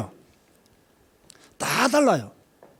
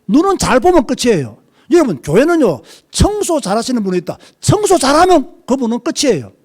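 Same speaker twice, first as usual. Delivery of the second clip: louder and higher, driving each point home; full-force preaching; 6 semitones higher, 5 dB louder than usual. Real, not acted. Pastor rallying the congregation to value every member, full-force preaching. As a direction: preach it out hard to the whole church.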